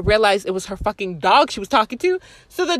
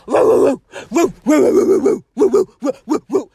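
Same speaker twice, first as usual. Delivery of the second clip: imitated dog barking; 4 semitones higher, 6 dB louder than usual.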